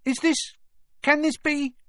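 can't believe who's in front of him; amazed old barman. A narrator's reading. Old barman stunned at seeing Harry, high and amazed. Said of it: "said the barman, peering at Harry".

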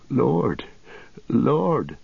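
choked, grieving plea; broken voice. Acted. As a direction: say it as if breaking down. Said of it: "began Merry brokenly".

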